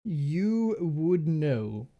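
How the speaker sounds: very slow, weighty "You would know"; a slow, meaningful nod of a line; slow knowing confirmation. Acted. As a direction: lean on each word, drawn out. GM confirms the character's knowledge.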